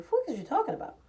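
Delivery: ordinary delivery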